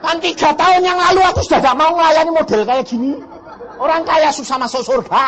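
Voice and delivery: high, loud, laughing rant at God; a loud, laughing complaint to God; angry complaint. Retold or imitated. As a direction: vent the grievance in a mock rage.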